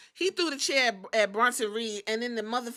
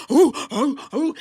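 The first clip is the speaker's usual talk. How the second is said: imitating riled-up wrestler; 3 semitones higher, 7 dB louder than usual. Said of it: "He was like".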